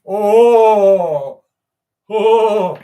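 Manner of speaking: loud creeped-out groan; shuddering oh. Real, not acted. Streamer reacting to a creepy hag story, loud shudder.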